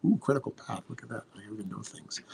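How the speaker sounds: a quiet, amused throwaway aside; joking self-brag. Real, not acted. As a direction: mutter it to yourself, tongue in cheek. Self-mocking aside after jargon.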